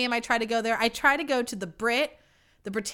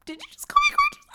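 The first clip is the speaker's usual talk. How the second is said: shocked squeal; 6 dB louder, faster than usual. Retold or imitated. Shocked at a stranger's compliment.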